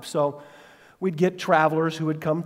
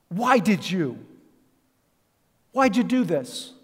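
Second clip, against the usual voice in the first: slow, drawn-out accusing why, rising higher; Joshua's accusing lament; 4 semitones higher, 3 dB louder, slower than usual. Retold or imitated. Joshua accusing God, lightly acted lament. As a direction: press God for an answer. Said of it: "right in the middle of that first verse".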